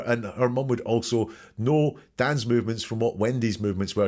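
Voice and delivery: ordinary talk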